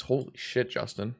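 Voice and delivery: ordinary talk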